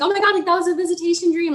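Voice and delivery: high, quick excited gush; retold excitement. Woman reliving her reaction to her boyfriend's dream, excited. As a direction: gush it out, pitched high and fast. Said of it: "I was so excited for him".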